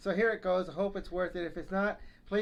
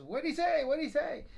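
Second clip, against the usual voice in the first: put-on high, louder old wife straining to hear; hard-of-hearing wife; 9 semitones higher, 4 dB louder than usual. Deaf wife asking her husband, high and loud. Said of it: "wife says".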